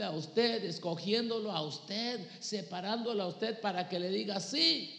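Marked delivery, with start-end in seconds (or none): 4.4-4.9 loud, high shouted sí; eager yes. Acted. Preacher voicing a believer accepting the challenge, shouting yes. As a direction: burst out with a yes to God.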